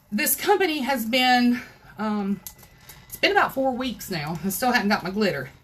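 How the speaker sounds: ordinary delivery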